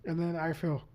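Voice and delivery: ordinary delivery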